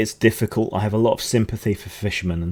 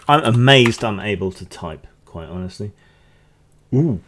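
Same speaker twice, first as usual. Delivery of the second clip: normal talking voice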